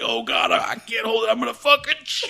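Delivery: high, strained cry of desperation; high-pitched panicked wail; mock panic. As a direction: act out losing control in the car. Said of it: "I'm like".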